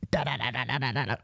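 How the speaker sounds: soft staccato "da, da, da" like gunfire; quiet mock gunfire sounds; machine-gun imitation. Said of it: "like if you were a machine gunner".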